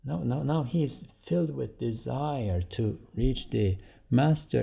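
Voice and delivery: ordinary talk, nothing marked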